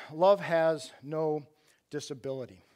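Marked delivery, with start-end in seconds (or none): 0.1-1.5 love has no drawn out slowly and deliberately; slow emphasis. Shown as a demonstration. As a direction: stretch love has no word by word. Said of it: "But the message is".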